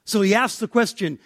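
normal talking voice

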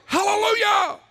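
high, loud hallelujah shout; joyful shout. Retold or imitated. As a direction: throw the hallelujah up to the rafters. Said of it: "I was like".

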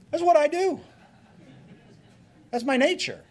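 very high, loud comic scorpion voice; hammed-up high cartoon scorpion; unrepentant scorpion. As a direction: squeal it out as the scorpion's excuse. Scorpion explains itself in a fable.

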